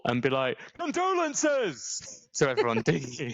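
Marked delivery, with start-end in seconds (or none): 0.8-2.3 long drawn-out high shout; shouted condolences. Pretend. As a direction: bellow it at mourners. Mock shouting at a funeral.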